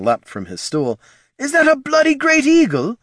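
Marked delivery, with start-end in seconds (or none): 1.4-3.0 shocked shout. Acted. Halfling shocked at an eagle.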